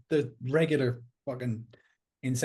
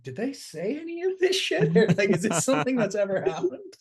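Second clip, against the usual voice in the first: pitched way up, disbelieving and laughing; a squeaky mock reporter who can't believe it; incredulous; 8 semitones higher, 3 dB louder than usual. Mocking journalists' disbelief.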